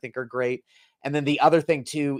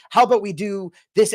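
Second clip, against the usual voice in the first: high, loud eager pitch; eager suggestion; 10 semitones higher, 6 dB louder than usual. Retold or imitated.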